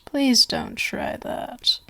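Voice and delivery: slow pleading whisper. Acted. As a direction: plead softly, slowly. Pleading, guest tries to bolt.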